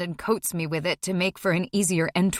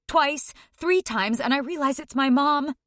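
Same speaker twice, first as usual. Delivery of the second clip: ordinary delivery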